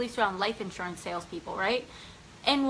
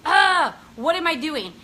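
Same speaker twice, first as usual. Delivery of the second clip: a loud, frustrated "Ah" that pitches up; retelling being fed up with herself; frustrated outburst; 4 semitones higher, 7 dB louder, slower than usual. Retold or imitated. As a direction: burst out at yourself in frustration. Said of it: "And I would be like".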